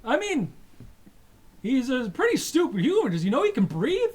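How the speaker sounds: scornful alien guard. Acted. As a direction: sneer at the stupid human. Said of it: "He's like".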